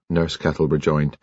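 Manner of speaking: ordinary delivery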